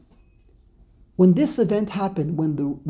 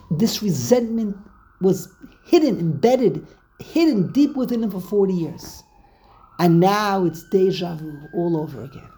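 usual speaking voice

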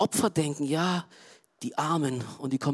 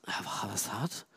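quiet self-doubt; 8 semitones lower, 14 dB quieter than usual. Real, not acted. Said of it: "denke ich so".

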